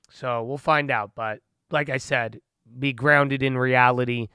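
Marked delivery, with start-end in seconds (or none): none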